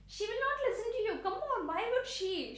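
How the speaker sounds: high, quick mock exasperation; mild exasperation. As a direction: brush off the pointless advice, pitched high. Voicing exasperation at pointless advice.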